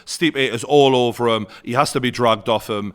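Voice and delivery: ordinary talk, nothing marked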